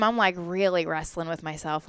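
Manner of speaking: usual speaking voice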